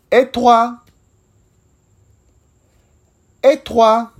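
"Et toi?" high and bright, clearly said; repeat-after-me French. Shown as a demonstration. Teacher drilling learners, clear repeatable French. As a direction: model "Et toi?" cleanly for copying. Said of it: "Ahora escucha y repite".

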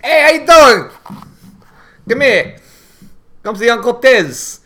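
high, loud New Jersey Italian uncle; hammed-up Italian-American uncle; mock Italian-American. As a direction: greet them like a Sopranos wiseguy. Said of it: "like a true Italian".

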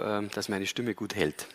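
usual speaking voice

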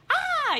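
imitated scream. Guest acting out Susana screaming at the red eyes, shriek. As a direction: mimic a horrified scream. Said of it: "empiezan a gritar las dos".

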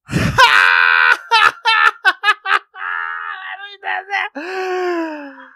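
high, loud cackling laugh; loud laughing fit. Real, not acted. Cracking up at the memory, loud shrieking laugh.

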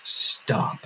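hollow, echoing command to stop. Acted. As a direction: boom it out like an empty suit of armor. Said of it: "Yells out in this hollow voice".

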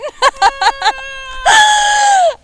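high, loud shrieking laughter. Real, not acted. Laughing hard about channelling Indian accents, loud. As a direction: let the laugh burst out.

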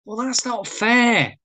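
high, drawn-out complaint; slow, high-pitched objection; wounded protest. Acted. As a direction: whine it, stretching out the last word. Wayne protests being turned away.

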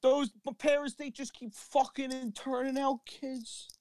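high, mocking Jersey voice; mock Jersey accent. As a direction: keep the high Jersey guy going.

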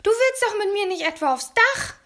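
loud, very high panicked screech; high-pitched alarmed yelling; shrieking alarm. A narrator's reading. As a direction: wail it like a siren gone haywire. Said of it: "Er klang wie eine durchgedrehte Alarmsirene".

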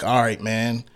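low, soft, confidential commissioner; hushed mock commissioner. Commissioner quietly letting a player off the hook, hushed. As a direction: murmur it like a back-room deal. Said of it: "let you like".

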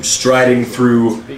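usual speaking voice

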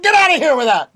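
high, loud angry shout. Acted. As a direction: yell him out of the bar. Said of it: "He's like".

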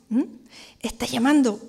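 ordinary talk, nothing marked

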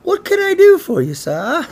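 a sing-song fake-posh hello; prissy clerk greeting. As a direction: greet like an overly polite clerk. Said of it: "but he went".

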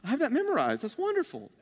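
lilting, over-agreeable parody of church folk; blithely churchy. Acted. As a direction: coo it with mock pious contentment. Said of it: "we're so used to church".

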